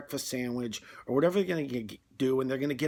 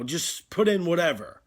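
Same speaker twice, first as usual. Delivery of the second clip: louder, higher, giving up; exasperated; 5 semitones higher, 6 dB louder than usual. Retold or imitated. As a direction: throw up your hands and blurt it. Customer gives up ordering.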